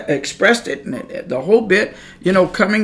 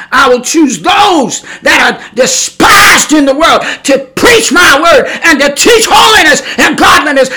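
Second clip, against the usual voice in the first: God's proclamation; 7 semitones higher, 6 dB louder than usual. Retold or imitated. The Lord reminding him of Paul, booming proclamation. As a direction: declare it like God on high.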